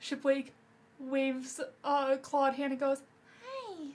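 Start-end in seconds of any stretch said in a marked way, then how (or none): 3.4-3.9 a hint of a little kid, high and soft. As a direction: pitch it up high and tiny like a small child. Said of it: "in the voice of a little kid".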